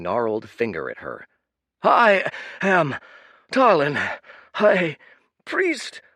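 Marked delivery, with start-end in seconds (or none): 1.8-6.0 wheezing proclamation. Acted. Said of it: "he wheezed".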